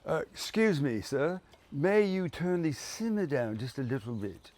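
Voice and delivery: mock posh request; posh West London. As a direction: ask ever so politely, West London style. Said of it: "let's do West London".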